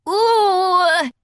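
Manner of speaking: high ooh of surprise; surprised exclaim. A narrator's reading. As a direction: squeal with surprise. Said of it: "Ozzy exclaimed".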